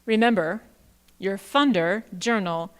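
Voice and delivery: ordinary delivery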